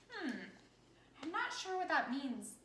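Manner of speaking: normal talking voice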